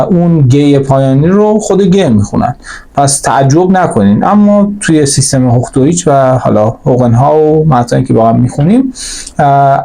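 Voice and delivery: ordinary talk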